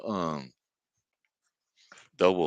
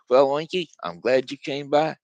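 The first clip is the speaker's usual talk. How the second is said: higher, louder cheerful pig voice; pig Doinky; 6 semitones higher, 5 dB louder than usual. Acted. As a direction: greet him brightly like a confident little pig. Second pig welcomes his brother.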